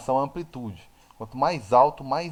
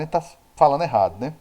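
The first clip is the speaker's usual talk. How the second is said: ordinary talk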